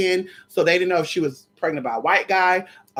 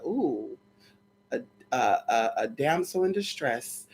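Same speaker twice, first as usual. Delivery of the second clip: soft, low, slow mock flirting voice; a hint of a smooth flirt, hushed; mock suitor; 6 semitones lower, 9 dB quieter, slower than usual. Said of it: "he said".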